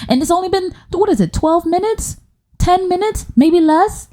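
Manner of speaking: squeaky, high questioning; comic high-pitched exasperation; high-pitched incredulity. Real, not acted. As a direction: count up high in disbelief. Flustered counting her tangents.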